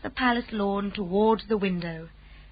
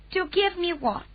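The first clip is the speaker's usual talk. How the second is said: a lightly voiced calm Queen, higher; gracious royal command, a touch higher; 9 semitones higher than usual. A narrator's reading. Queen asks.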